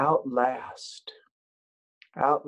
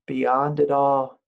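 ordinary delivery

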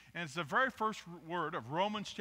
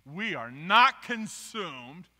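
slow, loud, rising scripture proclamation; verse recital; 11 dB louder, slower than usual. Shown as a demonstration. Preacher reading Lamentations to the church, slow and loud. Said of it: "I think it says".

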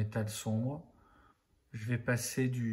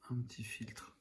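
whispered aside; 9 dB quieter than usual. Real, not acted. Murmuring to self while painting.

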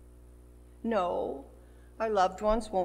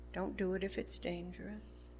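"Don't do it if it's dangerous." repeated much softer, with a rise on "do"; soft repeated warning; 3 semitones lower, 12 dB quieter than usual. Shown as a demonstration. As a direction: drop the voice right down and repeat it as a gentle, earnest caution. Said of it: "Be careful".

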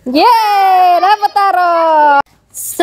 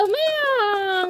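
kitten voice; 3 semitones higher, 10 dB quieter than usual. Shown as a demonstration. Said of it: "Kayak gini".

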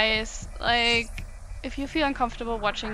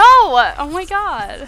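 drawn out very slowly, higher and much louder; slow dismayed cry; 5 semitones higher, 16 dB louder, much slower than usual. Real, not acted. Upset by the cruel message, a slow, loud dismayed cry. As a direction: let the dismay spill out.

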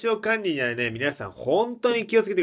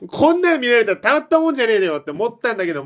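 exasperated rant; 7 semitones higher, 7 dB louder, faster than usual. Real, not acted. Exasperated fast.

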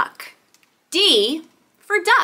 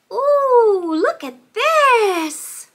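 high, slow, drawn-out amazement; excited wonder; 8 semitones higher, 3 dB louder, much slower than usual. Pretend. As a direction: present a marvel to small children. Reveals a new toy.

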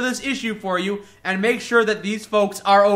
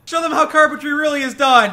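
shouted cheer; 8 semitones higher, 4 dB louder than usual. Real, not acted. Cheering on carpenter.

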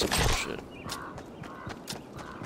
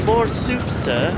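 lightly voiced pleading orphan, pitched up; a mock Oliver Twist asking for more; begging orphan voice; 12 semitones higher, much slower than usual. Mocking his little brother as a begging orphan. Mimicking kid begging for soup.

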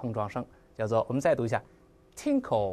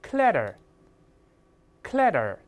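"clatter" said a bit quicker, the t softening toward a d; quicker model take of "clatter"; faster reading; 4 semitones higher, much slower than usual. Shown as a demonstration. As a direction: pick up the pace on "clatter", letting it flow. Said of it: "我们再读快一点".

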